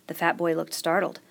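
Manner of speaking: plain reading voice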